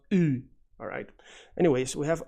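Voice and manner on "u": "u" as a drawn-out ü; long fronted ü for "u"; foreign u sound. Shown as a demonstration. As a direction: say "u" like the ü of other languages. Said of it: "this letter is pronounced".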